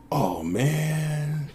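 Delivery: deflated, drawn-out quiet groan of words; muffled disappointment. Acted. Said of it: "through the door, you hear".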